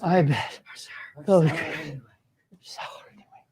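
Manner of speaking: exhausted whisper with no voice left. Shown as a demonstration. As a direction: breathe the words out, totally worn out. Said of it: "let's try it with a exhausted voice".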